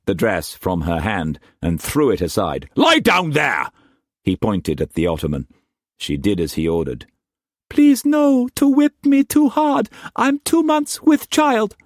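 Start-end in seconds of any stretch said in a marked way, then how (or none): 2.8-3.7 higher, louder raging master's voice; furious master. A narrator's reading. Struan raging at Mei Mei before whipping her, furious shout. 7.7-11.8 put-on very high, pleading girlish voice; pleading young woman. A narrator's reading. Mei Mei begging Struan not to whip hard, high frightened pleading.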